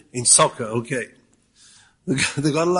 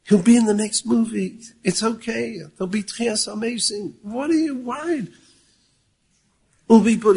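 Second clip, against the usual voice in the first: normal talking voice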